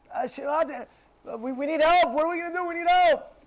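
high, pleading mock outcry; crying out for help. Trapped people crying out to Hashem for help, urgent outcry. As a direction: call out in rising, urgent desperation. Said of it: "They scream out".

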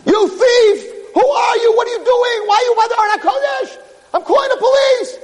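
high-pitched, furious accusing shout; screaming. Acted. Rabbi catches a man at the ark, screaming. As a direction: shout as if catching a thief red-handed. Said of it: "he starts screaming".

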